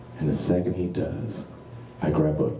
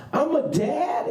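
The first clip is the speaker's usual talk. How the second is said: laughing; 4 dB louder, slower than usual. Real, not acted. Punchline callback, laughing.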